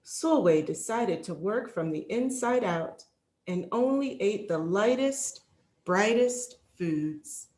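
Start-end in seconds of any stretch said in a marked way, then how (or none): none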